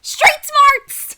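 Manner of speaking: very loud, high outburst; mock battle shout. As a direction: yell it as a comic slogan. Shouted repeat in comic argument.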